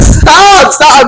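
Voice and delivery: high, drawn mock cry of protest; panicked cry. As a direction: act out pleading while being tossed about. Reliving being thrown around on stage.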